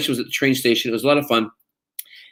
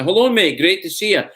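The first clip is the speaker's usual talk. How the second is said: higher, hearty Scottish greeting; Scottish accent; 6 semitones higher than usual. Retold or imitated. Actor greets muscular doorman at restaurant, Scottish accent. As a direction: greet him in chummy Scots. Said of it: "speak with a Scottish accent".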